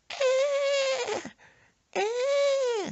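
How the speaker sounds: very high bleating scream; demented sheep scream. Retold or imitated. Grandma trapped under her robe, sheep-like scream. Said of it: "sounded like a demented sheep".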